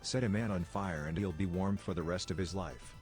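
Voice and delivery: ordinary delivery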